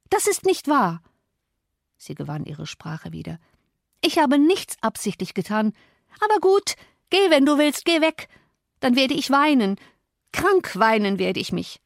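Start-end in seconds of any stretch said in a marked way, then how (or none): 0.1-1.0 high-pitched protest; piqued, high retort; indignant denial. A narrator's reading. Catherine denies his accusation. 4.0-11.8 high, agitated sulk; wounded, high-pitched threat of tears; petulant outburst. A narrator's reading. Catherine petulantly threatens to cry herself sick.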